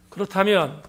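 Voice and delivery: ordinary talk, nothing marked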